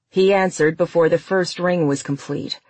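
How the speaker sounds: usual speaking voice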